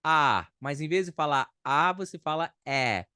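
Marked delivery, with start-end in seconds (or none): 0.0-0.5 a loud open Portuguese "A"; teacher's loud vowel demo; mouth shaped for A. Shown as a demonstration. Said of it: "pra falar o A".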